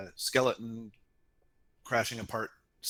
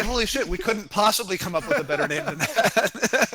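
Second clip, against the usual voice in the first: higher, louder, thrilled exclamation; excited; 8 semitones higher, 5 dB louder than usual. Retold or imitated. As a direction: blurt it out in amazed delight. Retelling team's thrilled reaction.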